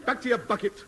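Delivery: high-pitched, urgent scolding; sharp shooing. Acted. Suitor to an interrupting animal, high indignant shooing. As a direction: drive the intruder away in a high yelp.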